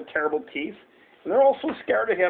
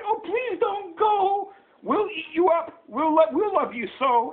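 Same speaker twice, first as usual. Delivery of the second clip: high, plaintive monster plea; wild things pleading; 11 semitones higher than usual. A narrator's reading. Wild things begging Max to stay, high pleading. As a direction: beg in a drawn-out high voice. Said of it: "But the wild things cried".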